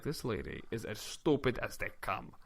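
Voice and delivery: low, soft mock Russian accent; lightly put-on Russian voice, quietly deadpan. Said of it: "That's your Russian accent?".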